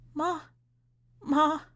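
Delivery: high, effortful cry of a single word; strained through crying. A narrator's reading. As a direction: struggle to get the word out. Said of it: "With some effort, she could only manage to say".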